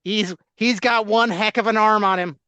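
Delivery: put-on Chicago accent. Host mocking Bears fans in a loud Chicago accent. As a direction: play a beefy Chicago superfan. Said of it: "I can't even do a Chicago accent".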